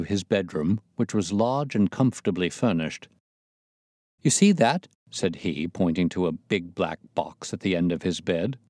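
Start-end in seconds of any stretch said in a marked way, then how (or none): 4.2-4.9 high, loud, edgy question; jangled nerves. A narrator's reading. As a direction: point it out in an agitated, pitched-up voice. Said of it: "as well as his voice testified to his jangled nerves".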